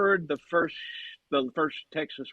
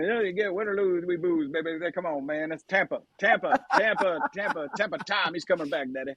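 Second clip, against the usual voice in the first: a lightly done Southern racer drawl, partying; Ricky Bobby Southern drawl; 2 semitones higher than usual. Acted. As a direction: play up a Southern good-old-boy accent. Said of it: "It's pretty good Ricky Bobby".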